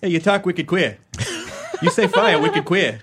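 mock Boston schoolyard taunt; Boston accent. Playing a schoolmate mocking someone's speech, in a Boston accent. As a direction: taunt them with dropped Boston r's. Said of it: "insult people with a Boston accent".

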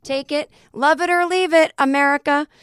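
high, loud mock defiance; a defiant anti-Christmas decree; defiant declaration. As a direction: announce it like a proclamation. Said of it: "where I said".

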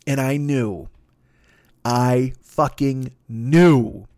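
drawn-out, heavy realization; slow and dramatic. Real, not acted. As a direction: let each word land slowly.

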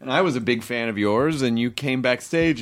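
usual speaking voice

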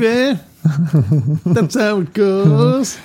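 high, slow hammed-up voice; goofy mock voice. Friend riffing on gravy in a silly high voice. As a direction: clown it up.

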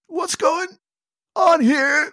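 high voice squeezed out in bursts; squeezed and strained. Acted. Crushed hero to centaur, strained and squeezed. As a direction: push the words out from crushed lungs. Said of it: "a little bit of a strain to squeeze it out".

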